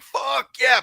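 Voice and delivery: high, loud mock cheer; imitating his own hyped cheer; retold excited cheer. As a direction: blurt it out like the system finally slaps. Retelling excitement over loud system.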